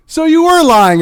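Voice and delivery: high, loud, through a laugh; laughing accusation. Real, not acted. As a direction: laugh it out in disbelief.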